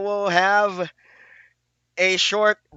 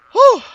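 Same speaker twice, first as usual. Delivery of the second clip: exhale; 11 dB louder than usual. Real, not acted. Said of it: "I am a little out of breath".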